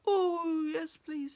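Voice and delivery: loud, high pleading moan; wailing tree voice; suffering tree. Acted. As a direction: groan it gratefully like a tree in pain. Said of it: "says the tree".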